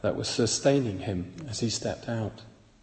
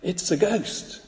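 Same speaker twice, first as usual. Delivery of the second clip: mild fearful cry; 6 semitones higher than usual. Acted. Preacher reading the disciples' terror at seeing Jesus, mildly fearful. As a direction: cry out slowly with a touch of fear. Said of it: "In fear, they cried out".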